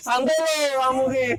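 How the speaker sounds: cracking up at a calm spectator; teasing through real laughter; laughing. Real, not acted.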